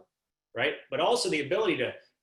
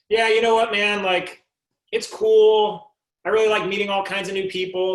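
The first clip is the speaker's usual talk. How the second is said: loud, higher-pitched casual guy; imagined waiter's reply; 5 semitones higher, 9 dB louder than usual. Pretend. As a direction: play the chatty server, pitched up and projected. Voicing the waiter's typical answer.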